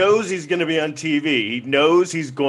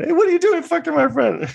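much higher put-on voice of a girlfriend; outraged girlfriend; 14 semitones higher, faster than usual. Girlfriend walking in on you with her friend, high-pitched outrage. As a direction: squeal it as the girlfriend catching you.

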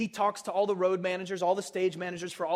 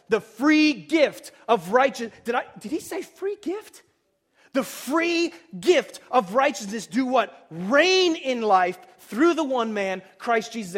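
emphatic scripture reading; 3 semitones higher, 6 dB louder, slower than usual. Acted. Reads the verse with emphasis.

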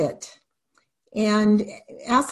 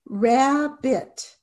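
rab-bit, each syllable tapped apart. Shown as a demonstration. Teacher tapping out syllables for students, slow and separated. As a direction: tap out "Rabbit" syllable by syllable, slowly. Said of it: "And you tap it out".

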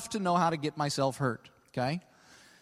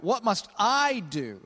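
slow it down and lean on "I"; stress on I; 3 semitones higher, slower than usual. Shown as a demonstration. Preacher shows the lawyer's emphasis, stress on "I". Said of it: "That's what his emphasis is".

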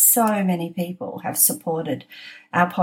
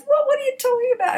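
daughter's voice; faster than usual. Retold or imitated. Daughter's voice retold.

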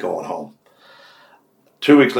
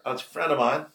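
usual speaking voice

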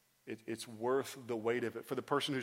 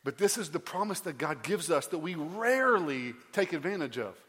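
louder and much higher, fervent; full-force preaching; 4 semitones higher, 6 dB louder than usual. Acted. Preacher fervently telling the congregation about his bedtime prayer. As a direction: preach it, voice soaring high.

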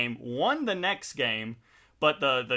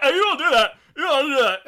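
put-on high, loud angry teenage yell; worked-up, high-pitched shout; retold yelling; 13 semitones higher, 9 dB louder, faster than usual. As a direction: yell it like an emotional high schooler mid-fight. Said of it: "I was just yelling".

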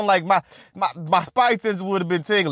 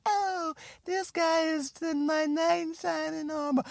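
much higher and softer, swooning; breathy dreamy girl voice; 7 semitones higher, 10 dB quieter than usual. Retold or imitated. Comedian mocking the naive woman's crush, high and soft. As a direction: sigh it like a starstruck girl.

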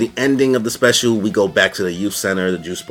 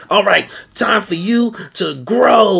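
high, slow, theatrical villain queen; villain Rita voice; 11 semitones higher, 3 dB louder, slower than usual. Retold or imitated. Rita telling Snizard to grow, in a high campy villain voice. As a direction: camp it up as the evil queen ordering her monster. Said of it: "was like".

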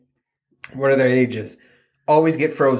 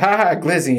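higher, mock giggly echo of a kid; kids' mimicking laugh; 6 semitones higher than usual. Kids echoing dad's laugh at a video, mock laughing. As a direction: imitate children laughing along, pitched up. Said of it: "And then they'll laugh".

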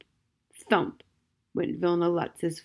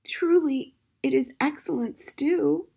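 through tears; 7 semitones higher than usual. Acted. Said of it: "he managed to say".

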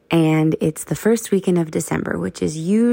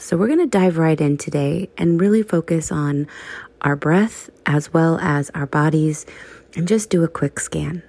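ordinary delivery

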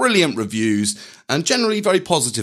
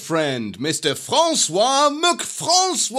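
announcer introduction; 10 semitones higher than usual. Acted. Announcing the musical guest.

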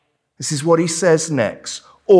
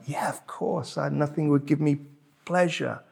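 soft, quick, mock-obliging parent; a hint of an agreeable parent, spoken softly; lightly voiced parent; 9 dB quieter, faster than usual. Parent answering a hungry child, lightly voiced parent. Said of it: "the parent is like".

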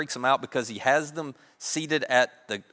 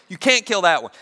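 loud, raised protest; frantic pleading; 5 semitones higher, 8 dB louder than usual. Acted. Brothers begging to save Benjamin, frantic pleading. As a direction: insist on it, pleading hard.

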